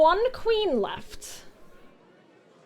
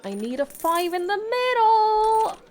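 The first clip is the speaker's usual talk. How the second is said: yelling; 8 semitones higher than usual. Real, not acted. Player begs the deck for a five, yelling. Repeated wish, now yelled.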